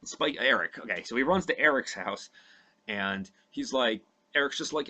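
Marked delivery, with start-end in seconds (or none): none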